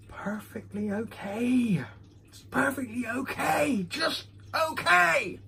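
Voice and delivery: drawn-out, high, put-on voice; high-pitched mock exclamation. Reviewer giving a verdict on the whisky, in a high mock exclamation.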